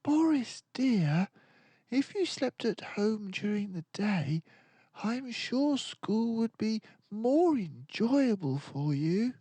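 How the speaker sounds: high, gently coaxing teacher; reasoning teacher. A narrator's reading. Missus Happy Snout reasoning with Boris, high and coaxing. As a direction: persuade him kindly. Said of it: "unhappily tried reasoning with him".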